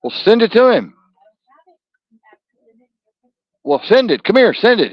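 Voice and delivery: loud, high calls to the producer; impatient shouted orders off mic; shouting. Real, not acted.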